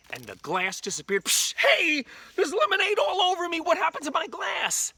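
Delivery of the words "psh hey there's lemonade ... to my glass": very high, loud, wailing protest; hammed-up squawk of dismay; shocked outcry. Drinker soaked in lemonade, shocked wailing. Victim's glass vanishes, lemonade spills.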